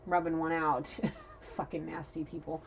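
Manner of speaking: ordinary delivery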